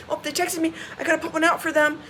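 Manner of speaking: lightly mocking take on a busybody; mimicked busy spot-saver.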